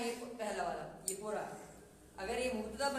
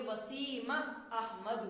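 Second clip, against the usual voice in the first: Wasim Ahmad drawn out, each syllable slow; slow Arabic model reading; much slower than usual. Shown as a demonstration. Teacher slowly models Wasim Ahmad for students. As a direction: read it slowly so students can copy. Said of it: "तो जब भी हम अरबी में की बोलेंगे ना".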